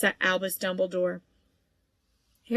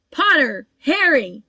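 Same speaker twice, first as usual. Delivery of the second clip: calling out; 6 semitones higher, 8 dB louder, slower than usual. A narrator's reading. Teacher calling out names.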